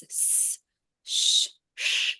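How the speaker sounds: s and sh as pure hissed air with no voice; voiceless hissing demo; whispered sibilants. Shown as a demonstration. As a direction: hiss out s and sh with only breath. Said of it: "And the sibilants, it's s".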